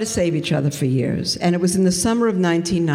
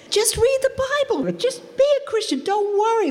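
dismissive reassurance. Retold or imitated. She brushes off her brother.

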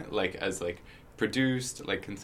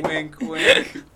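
high, loud teasing wink-wink; 14 dB louder than usual. Pretend. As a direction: play up the innuendo. Teasing innuendo.

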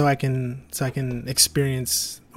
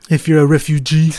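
loud mock South African accent; teasing line in a South African accent; 3 semitones higher, 9 dB louder, faster than usual. Host teases the guest in a South African accent, loud and joking. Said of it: "what accent are you doing though?".

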